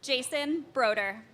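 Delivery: projecting names across the hall; firm carrying classroom voice; teacher voice. Real, not acted. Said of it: "I have to use my teacher voice".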